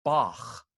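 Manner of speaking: "Bach" ending in a rasped ch from the back of the tongue; back-throat ch. Shown as a demonstration. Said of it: "the sound you get at the end".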